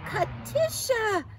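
high, loud, drawn-out name; thrilled, stretched-out greeting; awed admiration. A narrator's reading. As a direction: exclaim the name slowly in amazement. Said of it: "Clara said".

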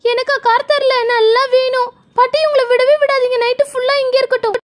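put-on high-pitched child, pleased at their punishment; gloating little girl. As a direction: egg grandma on in a high girlish voice. (பழி தீர்க்கும் சின்னப் பொண்ணு குரல்)

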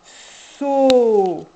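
"So" with a long loud held s; stretched syllable. Shown as a demonstration. Teacher demonstrating "So" for a child, loud and long. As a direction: hold the s, then the o. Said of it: "même chose avec toutes les syllabes".

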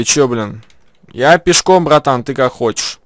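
ordinary talk

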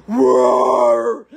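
loud mock roar. Retelling the cellmate's charge, loud mock roar. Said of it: "she was like".

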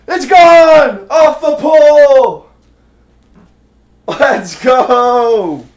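very high, loud triumphant shout; home run call. Real, not acted. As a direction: roar it like a broadcaster. Home run off foul pole.

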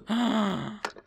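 startled sharp intake of breath; sharp gasp. Real, not acted. Gamer startled by a noise, sharp gasp. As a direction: gasp in sudden fright.

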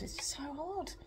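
real grief catching in the throat; voice breaking. Real, not acted. Talking to viewers about missing Mum, voice cracking with tears.

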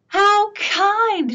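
an eager, happy dog. Acted. Dog speaks in story.

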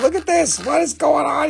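high-pitched excited amazement; amazed exclamation. Real, not acted. Seller showing buyers a wild stone, amazed high exclamation.

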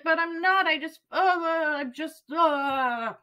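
high-pitched, helpless protest; protest, then break off into frustrated groans; acted-out wordless frustration; exasperated protest. Acts out frustrated reply to doctors.

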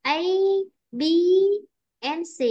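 A and B up, C down; teacher's demo list, falling only on C; list intonation. Shown as a demonstration. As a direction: lift A and B, let C fall. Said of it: "C là đi xuống lại".

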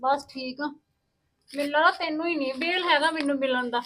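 quiet, sulky reply. Acted. Sister-in-law sulks at her brother's wife, quiet and cold. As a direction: mutter it like a hurt guest.

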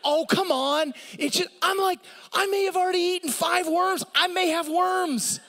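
high, loud mock panic; hammed-up whining; comic panic. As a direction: squeal in exaggerated disgust. Comic rant about the worm.

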